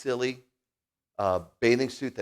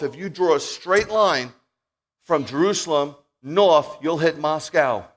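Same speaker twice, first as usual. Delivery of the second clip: loud, slow, higher-pitched declaration; emphatic preaching; 6 semitones higher, 11 dB louder, slower than usual. Acted. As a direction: drive the point home from the pulpit. Preaches on the prophecy.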